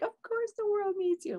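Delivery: high, loud, gushing encouragement; the girls cheering. Retold or imitated. Friends in the circle reassure her, high gushing voice. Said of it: "all the girls were fabulous in the circle".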